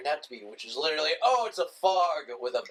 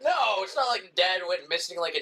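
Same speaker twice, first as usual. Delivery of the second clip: mock disbelief; 4 semitones higher than usual. Mocking a movie preview's giveaway, exaggerated sarcastic disbelief. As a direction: feign astonishment at an obvious plot twist.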